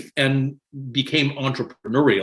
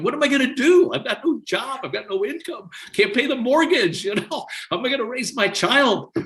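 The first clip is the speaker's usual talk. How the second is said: retold tearful worry; 11 semitones higher than usual. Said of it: "I was in tears going".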